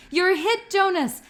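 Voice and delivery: loud, high playful yell. A narrator's reading. Asher yells at Jonas from behind the tree, loud and high. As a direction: call him out as shot in the game. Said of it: "Asher yelled".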